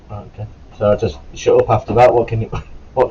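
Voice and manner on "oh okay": meek reply. Retold or imitated. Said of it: "I was like".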